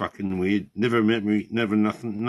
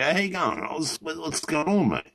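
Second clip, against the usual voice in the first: lifted, bright-pitched phone greeting, higher; imitating a cheery mate; 8 semitones higher than usual. Imitating Shep answering the phone with a higher cheery greeting. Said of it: "answer the fucking phone and go".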